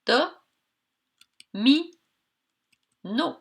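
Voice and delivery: "Do, mi, no" with a clear pause after each; three isolated beats: "Do", "mi", "no"; separated syllables. Shown as a demonstration. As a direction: give each syllable of "Do, mi, no" on its own. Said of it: "il y avait trois syllabes".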